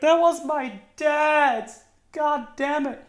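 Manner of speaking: hammed-up grieving yell; mock outraged cry. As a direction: cry out like the offended son in the back. Mourner's outraged cry from back.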